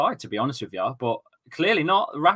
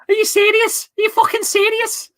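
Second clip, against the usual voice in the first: squeaky, much higher and louder mock Scouse mimic; a childlike, high-pitched Scouse take-off; James Redmond impression; 6 dB louder than usual. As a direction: imitate a disbelieving friend like a nine-year-old. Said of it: "when you do my impression".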